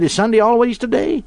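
put-on high, insistent child's question; three-year-old son. Son pestering his father at bedtime, childlike insistence. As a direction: imitate a small boy who will not drop it. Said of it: "our son was just three years old".

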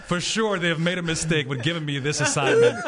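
high-pitched, laughing bewilderment; laughing mock dismay. Storyteller stuck at the urinal on duty, laughing mock dismay. Retold inner panic, laughing.

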